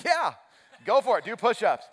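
high, loud go-ahead; mock-enthusiastic yes. Coach giving in to first graders, high loud cheer. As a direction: shout permission with put-on cheer. Coach answering the eager kids.

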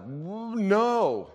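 high, loud mock-alarmed no; stammer, then burst out high in mock dread; comic alarmed refusal. Acts out his alarmed refusal.

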